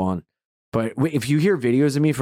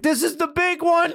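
panico recitato. Retold or imitated. Panicked thought about a terror attack.

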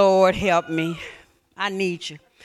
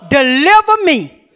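"deliver me" drawn out slowly and louder, with "deliver" stressed; slow fervent prayer; 4 semitones higher, 7 dB louder, slower than usual. Shown as a demonstration. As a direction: pray "deliver me" slowly and earnestly. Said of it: "Put your hand on your heart. Say".

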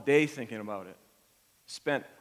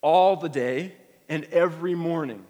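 "All" punched loud, whole line louder; stress on all; 3 semitones higher, 7 dB louder than usual. Shown as a demonstration. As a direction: hammer "All the day and every morning". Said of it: "He says".

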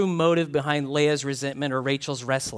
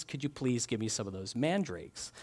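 softer, sweetly polite request; 4 semitones lower, 10 dB quieter than usual. Retold or imitated. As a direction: ask gently, very politely. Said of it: "she's very polite about it".

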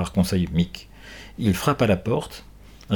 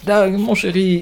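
tender to dog; 10 semitones higher, 4 dB louder, slower than usual. Acted. Girl comforts wounded dog.

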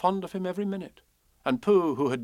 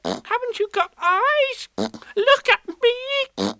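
squeaky shouting; 8 dB louder, much slower than usual. A narrator's reading. Piglet keeps shouting.

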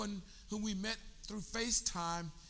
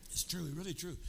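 ordinary delivery

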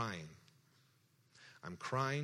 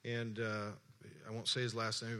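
normal talking voice

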